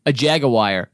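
Jaguar as JAG-uh-wire; imitated mispronunciation. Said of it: "if you just met Bill".

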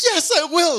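squeaky high excited yes; high girly bride voice. Retold or imitated. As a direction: gush it like a giddy bride. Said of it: "She's like".

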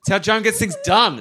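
ordinary talk, nothing marked

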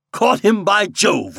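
high, loud gloating; gleeful triumph. A narrator's reading. Kravitz crowing to his friend Randall, high and loud. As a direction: crow over the trick. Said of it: "laughed Mister Kravitz".

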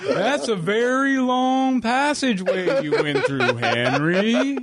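drawn-out, high, sing-song put-on voice; exaggerated slow impression, swooping up in pitch. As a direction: stretch every word slowly in a silly impression.

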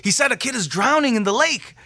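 high-pitched retold alarm; urgent alarm. Worker translates for the leaders, urgent alarm.

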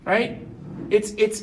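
ordinary talk, nothing marked

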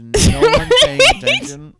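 high, loud incredulous shout; exasperated yell. Retold or imitated. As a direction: yell it in disbelief at the screen. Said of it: "where you are like".

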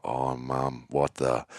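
hushed protest. Retold or imitated. Caught out by mum.

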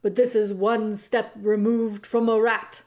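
loud, scornful royal outburst; disgusted king. Acted. The king scorns the mouse, loudly. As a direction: spit out the disgust.

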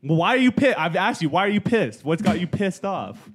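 high, loud exasperated yell; mock yelling. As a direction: yell it back, playfully exasperated. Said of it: "I'm sure that sounded great".

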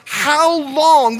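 high, impassioned cry. Acted. Jesus crying out to God for his people, impassioned plea. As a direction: call it out as an urgent plea. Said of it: "he cries out".

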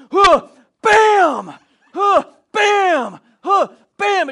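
high, loud grunt then bam; door-slam grunts. Retold or imitated. Uncle Mike ramming the bathroom door, loud grunting bam. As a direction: shout each blow as the door is hit.

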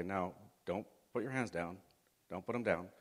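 usual speaking voice

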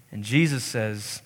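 ordinary talk, nothing marked

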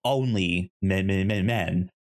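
usual speaking voice

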